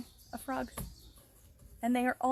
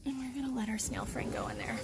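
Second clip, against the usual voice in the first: fast whisper to keep from scaring the snail; brisk whispered aside; 2 semitones lower, 4 dB quieter, faster than usual. Real, not acted. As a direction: hush it out quick while leaning over the pond. Quietly releasing the snail.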